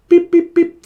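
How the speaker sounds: high imitated dropout beeps; phone beeps. Faking a call with bad signal, high beeps. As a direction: beep like a failing phone line. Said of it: "It's a bit of a bad signal".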